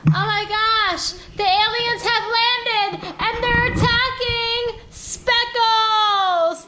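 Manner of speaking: high, slow dramatic cry of alarm; mock alarm. Parent announcing aliens attacking Speckles, slow high mock alarm. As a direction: draw it out like a pretend disaster.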